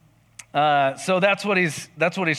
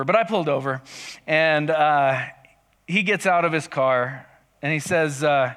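normal talking voice

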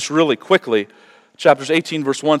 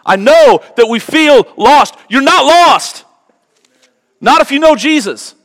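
high, loud, impassioned sermon cry; fiery pulpit preaching, high and loud; full-force preaching; 12 semitones higher, 8 dB louder than usual. Acted. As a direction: preach it out to the whole room.

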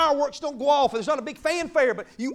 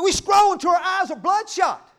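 loud, raised exclamation; preaching shout; 6 semitones higher, 9 dB louder than usual. Acted. Preacher mocking endless scrolling, shouting. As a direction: shout it at the crowd.

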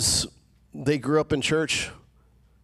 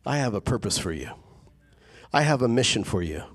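voice of God; 7 semitones lower, faster than usual. Retold or imitated. God speaks to the weary believer, low and gentle. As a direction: speak as a patient father who won't let go. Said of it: "he says".

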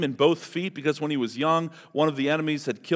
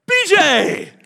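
a loud, high, delighted call of the name; joyful welcome; 11 dB louder than usual. Shown as a demonstration. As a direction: greet him like you've missed him for years. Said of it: "This is how he welcomes you".

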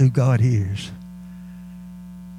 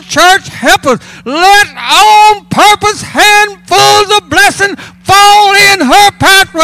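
high, shouted revival preaching, words held out; full-force preaching; 13 semitones higher, 9 dB louder than usual. Real, not acted. Preacher rousing the church over handfuls of blessing, full-force preaching. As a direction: preach it loud and high, driving every phrase.

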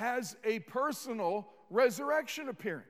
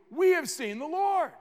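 insistent; 6 semitones higher, 6 dB louder than usual. Acted. Ten disciples insist to Thomas.

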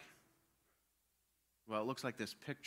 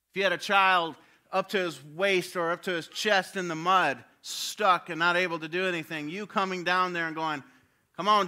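loud, high, impassioned preaching; preaching at full force; 6 semitones higher, 10 dB louder than usual. Acted. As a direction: drive the point home from the pulpit.

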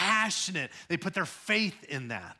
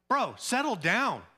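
higher, slower scolding bro; rebuking onlooker; 6 semitones higher than usual. Retold or imitated. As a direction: tell him to settle down, mildly. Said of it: "they rebuked him".

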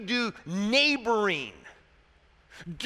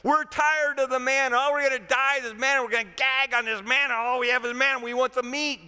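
rapid-fire mock griping, higher and louder; complaining; 5 semitones higher, 4 dB louder, faster than usual. Israelites sick of eating manna demand meat, complaining. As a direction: rattle off hammed-up complaints, one over another. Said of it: "They complain".

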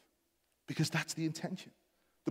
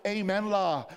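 loud, raised congregational shout; shouted amen; 7 semitones higher, 9 dB louder, slower than usual. Acted. Preacher voicing the Scouse worshippers he longs for, shouted amen. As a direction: call it out like a fervent Scouse worshipper. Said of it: "I want Scouse voices shouting".

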